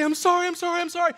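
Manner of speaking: a hammed-up, tearful string of sorrys; pleading apology. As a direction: act out her breathless pleading apology. Bride retold begging forgiveness.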